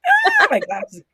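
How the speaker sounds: high, loud yelp of panic; hammed-up comic distress; mock panic. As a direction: cry out like someone knocked your glasses off. Acting being beaten up.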